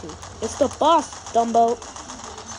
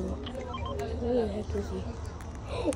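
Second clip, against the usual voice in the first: hushed whisper while hiding; 8 dB quieter, much slower than usual. Real, not acted. Hiding, searching for enemy.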